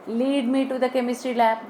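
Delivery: "Lead" with long ee, like leed. Shown as a demonstration. Teacher repeats guiding example sentence, long-ee "Lead". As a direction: say "Lead" to rhyme with need. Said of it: "different pronunciation and meaning".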